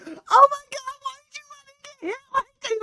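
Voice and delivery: very high whiny mock voice; very high whiny imitation; whiny complaint. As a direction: whine at him for letting it happen. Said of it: "she's like".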